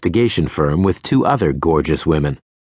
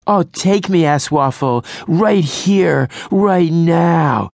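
much higher, hammy swoon; mock swooning; 10 semitones higher, slower than usual. A narrator's reading. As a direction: gush like a fake damsel.

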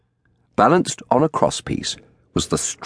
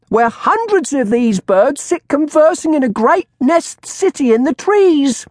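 shouting crone; 5 dB louder than usual. A narrator's reading. Old woman advertising her bird to the crowd, shrill shout. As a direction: proclaim it shrilly to the street. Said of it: "the crone shouted".

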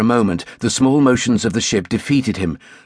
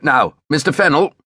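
raised-pitch order to fire; command; 5 semitones higher than usual. A narrator's reading. Captain to his lieutenant at the gun, commanding. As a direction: call the officer to act, voice lifted.

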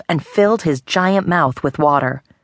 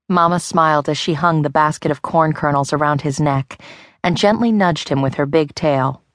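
ordinary delivery, nothing marked

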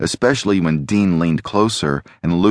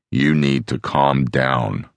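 low, slow grumble into his ear; Dean, grumbling; 4 semitones lower, slower than usual. A narrator's reading. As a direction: tell him slowly and low, close to his ear. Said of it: "Dean suddenly grumbled into his ear".

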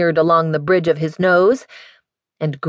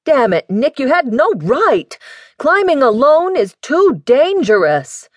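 raised, higher sputtering outburst; father sputters at his daughter in anger; 9 semitones higher, 3 dB louder than usual. A narrator's reading. Father scolds Nick for climbing alone, sputtering and raised. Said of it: "and sputtered".

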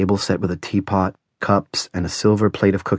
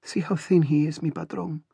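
high, very soft, near tears; Celia, ready to cry; 7 semitones higher, 9 dB quieter than usual. A narrator's reading. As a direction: plead softly and high, holding back tears. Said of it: "sounding ready to cry".

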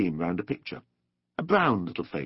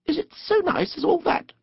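very high, small Mole voice; shy. A narrator's reading. Mole asks Rat whether boating is nice, shyly. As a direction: ask it timidly, high-pitched. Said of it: "asked the Mole shyly".

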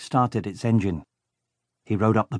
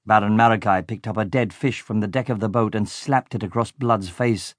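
plain reading voice

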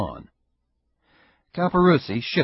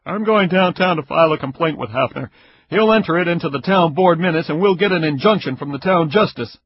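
louder, fast, blunt man's voice; stern investigator, irritated; 7 semitones higher, 9 dB louder, faster than usual. Acted. Caperuzzi threatening Ida with a complaint, loud and fast. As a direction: push through it like an angry official. Neighbor threatens legal action.

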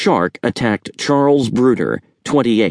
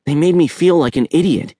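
put-on higher, hurt boy's voice; a boy stung by the joke, voiced high; Chet, higher voiced; 7 semitones higher than usual. A narrator's reading. Boy complaining to uncle, higher hurt voice. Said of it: "Chet said".

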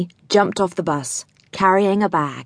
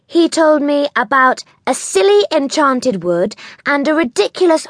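lightly voiced haughty town girl, a bit higher; scornful girl Connie; 8 semitones higher, 4 dB louder than usual. A narrator's reading. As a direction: sneer lightly at the silly tales. Connie scoffing at the stories.

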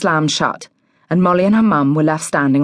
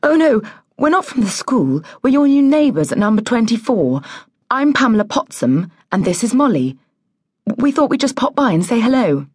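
higher, nervously friendly mum; nervous politeness; 6 semitones higher than usual. A narrator's reading. Mum introducing herself to the new neighbour, nervously friendly. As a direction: explain yourself politely, a touch flustered. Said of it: "Mum laughed nervously".

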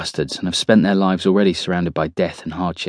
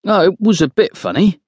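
high-pitched, placating reply; hasty, high consolation; quick reply; 11 semitones higher than usual. A narrator's reading. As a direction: jump in high to reassure. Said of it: "Cookie says quickly".

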